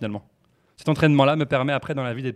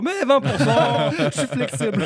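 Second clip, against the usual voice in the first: campy joking tone; 11 semitones higher than usual. Pretend. Joking about being flexible.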